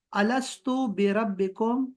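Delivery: "الستو بربکم" recited very slowly; slow recitation. Shown as a demonstration. Teacher reciting a Quran verse slowly to students. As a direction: draw out the Quranic question. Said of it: "مشہور سوال سنا ہوگا".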